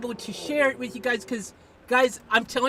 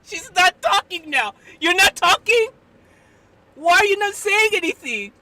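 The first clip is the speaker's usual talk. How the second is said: loud, high, astonished exclaiming; worked-up, raised-voice amazement; incredulous shouting; 5 semitones higher, 8 dB louder than usual. Real, not acted. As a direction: cry out to witnesses in disbelief. Astonished, appealing to stream witnesses.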